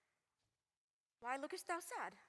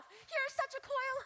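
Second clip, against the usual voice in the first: exasperated; 10 semitones higher than usual. Acted. Juliet exasperated.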